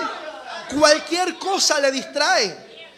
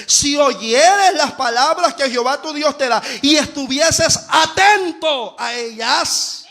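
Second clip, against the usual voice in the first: scripture recitation; 3 dB louder, slower than usual. Acted. Quotes scripture slowly.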